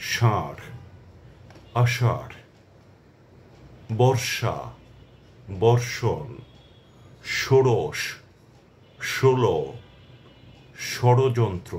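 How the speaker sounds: each word clear and separate, ষ said as sh; careful demo pronunciation. Shown as a demonstration. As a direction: pause after every word, sh sound on ষ. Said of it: "এখানেও কিন্তু এসএইচ এর মতো উচ্চারণ হচ্ছে".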